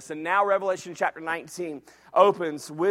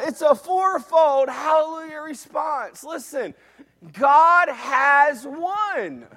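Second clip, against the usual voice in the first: ordinary talk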